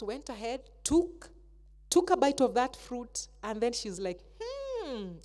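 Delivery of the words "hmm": savouring. Acted. Eve tasting the fruit.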